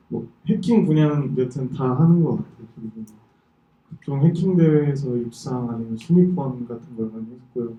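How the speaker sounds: usual speaking voice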